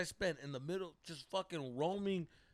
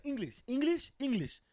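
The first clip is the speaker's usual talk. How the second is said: higher, pushy repeated demand for English; homeless man insisting; 8 semitones higher, 5 dB louder than usual. Retold or imitated. Homeless man insisting the stranger speak English, higher insistent voice.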